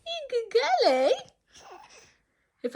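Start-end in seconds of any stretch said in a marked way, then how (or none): 0.1-1.1 very high, doting coo. Real, not acted. Praising her baby.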